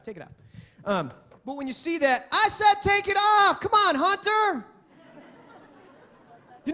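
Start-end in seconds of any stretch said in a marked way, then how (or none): 0.0-0.3 soft, low-key aside to the tech; quiet request. Real, not acted. Pastor quietly asking the tech to take the verse off, soft aside. 2.3-4.6 louder and higher, half-laughing insistence; firm loud repeat. Real, not acted. Pastor calling to Hunter in the booth, loud exasperated insistence. Tech ignores repeated request.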